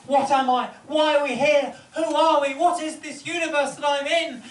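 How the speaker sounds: mock panic at the universe; hammed-up dread from friend Craig; panicked existential questions. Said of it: "really had an existential crisis".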